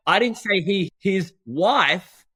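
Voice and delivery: "wife" punched at the end; stress on WIFE. Shown as a demonstration. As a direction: save the big emphasis for the word "wife". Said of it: "The word that you emphasize".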